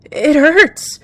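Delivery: loud, high wail of agony; pained cry. A narrator's reading. Poisoned young cat to her attacker, crying out. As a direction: cry out as the burning hits. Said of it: "Flickerpaw cried".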